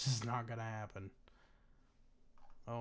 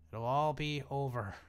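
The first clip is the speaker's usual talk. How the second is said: louder, slow, hammed-up doom announcement on ALL; mock dramatic proclamation; 2 semitones higher, 6 dB louder than usual. Shown as a demonstration. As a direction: play it like a mock epic narrator declaring the end. Dramatic repeat of the same phrase.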